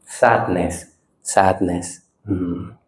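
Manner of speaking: low, soft naming of a feeling; name it softly and just watch; quiet noting. Shown as a demonstration. Teacher noting a feeling as it comes, quiet. Demonstrates calmly noting sadness.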